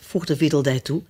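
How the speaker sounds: ordinary delivery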